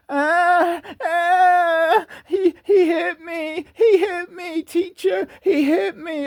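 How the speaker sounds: put-on high, slow, wailing little-kid sob; mock crying kid, high and whiny; crybaby child. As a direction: whimper to the teacher like a hurt six-year-old. Bully kid whines to teacher.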